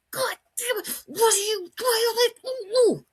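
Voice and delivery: high, strained scolding that gives way to noises; mock mad. Owner wanting to scold runaway dog, mock mad. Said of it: "you wanna be so mad at him and go".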